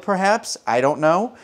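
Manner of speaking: normal talking voice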